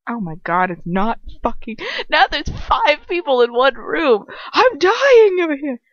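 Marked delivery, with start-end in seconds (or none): 2.1-5.8 high, loud comic wail; mock exasperation. Reader juggling five characters, hammed-up exasperation. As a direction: wail as if overwhelmed by it all.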